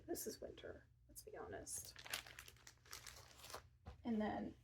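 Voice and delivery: ordinary delivery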